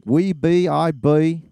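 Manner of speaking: rural British accent. Shown as a demonstration. Showing his cohost the phrase in a rural British accent. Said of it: "put a British accent on it".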